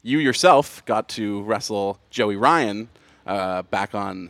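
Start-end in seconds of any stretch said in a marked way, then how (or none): none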